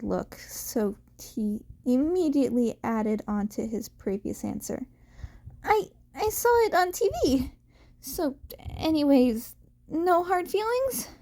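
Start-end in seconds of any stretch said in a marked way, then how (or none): 5.6-11.1 put-on high, fast, stammering boy's voice; high nervous boy. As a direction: blurt it out quickly in a high young voice. Izuku hastily adds to answer.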